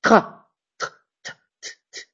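tr tr repeated, crisp t; t sound drill. Shown as a demonstration. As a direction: tap out the tr sound. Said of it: "comme dans travail".

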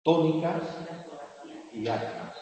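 teacher contrasting syllable types; stressed vs unstressed. Shown as a demonstration. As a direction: contrast the two words. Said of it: "al sistema de".

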